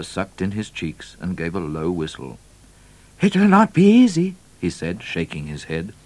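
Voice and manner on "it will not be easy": high, loud trooper's voice; doubtful trooper. A narrator's reading. Trooper warning the governor of a hard task, doubtful. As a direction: pitch it up and state it firmly.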